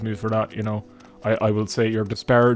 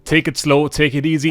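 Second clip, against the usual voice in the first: advice quoted; 4 semitones higher, 5 dB louder than usual. Retold or imitated. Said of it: "they do say to".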